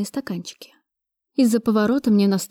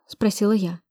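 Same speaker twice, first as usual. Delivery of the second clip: plain reading voice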